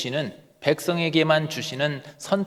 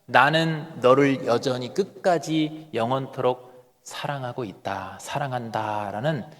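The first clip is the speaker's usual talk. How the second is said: tender reassurance; 5 semitones lower, 6 dB quieter, slower than usual. Acted. Jesus' loving reassurance voiced.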